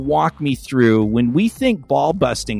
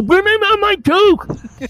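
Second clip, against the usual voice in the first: high demanding call; 3 dB louder, slower than usual. Retold or imitated. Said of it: "And I go".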